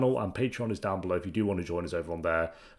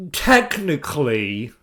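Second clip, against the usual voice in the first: drawn-out, louder mock "technically"; mocking repeat; 5 semitones higher, 7 dB louder, much slower than usual. Viewer mimicking the police officer, scornful. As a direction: parrot it slowly with scorn.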